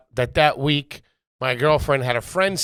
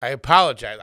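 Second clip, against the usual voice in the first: ordinary delivery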